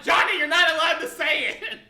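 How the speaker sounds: loud, laughing scold; laughing while telling him off; exasperated shout. Retold or imitated. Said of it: "I'm like".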